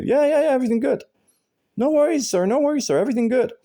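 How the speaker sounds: very high, thin mock voice; mocking the neighbor. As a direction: parody the neighbor brushing him off, pitched far up. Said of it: "And he would just say".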